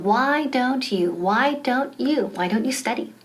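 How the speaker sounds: very slow, careful drill; slow model. Shown as a demonstration. Teacher drills the phrase slowly for learners. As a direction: stretch each word for students. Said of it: "So again".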